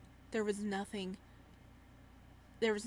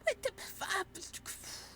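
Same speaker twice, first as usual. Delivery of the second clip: stunned whisper; 11 semitones higher, 3 dB quieter, much slower than usual. Real, not acted. Shocked at the beheading.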